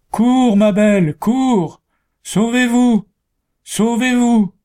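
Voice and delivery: high, loud desperate scream; dying woodcutter screaming to his wife. A narrator's reading. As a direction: scream to save them as he falls. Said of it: "le bûcheron hurlait".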